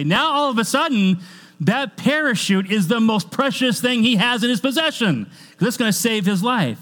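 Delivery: loud, high, driving pulpit voice; full-force preaching. Acted. Preacher pressing the parachute point on the congregation, loud and high. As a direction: preach it out over the whole room.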